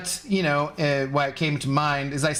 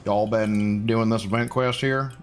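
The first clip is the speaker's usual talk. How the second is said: a clumsy mock cowboy accent to greet a viewer; bad cowboy accent; 7 semitones lower than usual. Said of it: "terrible, crappy cowboy accent".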